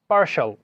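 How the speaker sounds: teaching পার্শাল, the ending as shal; partial as parshal. Shown as a demonstration. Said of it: "কিন্তু এটার উচ্চারণ হবে".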